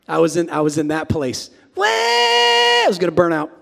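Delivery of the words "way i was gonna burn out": high shouted cry of rushing; hammed-up panic shout; frantic shout. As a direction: yell it like you're about to crash. Acting out frantic rush again.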